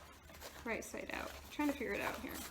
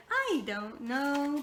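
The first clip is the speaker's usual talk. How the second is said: very slow drawn-out "I don't know"; "I don't know" dragged out in his voice; imitating the car guy; 3 semitones higher, 4 dB louder, much slower than usual. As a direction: stretch it lazily like the mechanic. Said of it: "why I was saying it like that".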